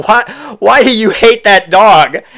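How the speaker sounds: high, loud, drawn-out disbelief; loud incredulity. Retold or imitated. Asking a drunk friend why he hates a puppy, loudly incredulous. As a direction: cry out, baffled by the absurdity. Incredulous after bursting out laughing.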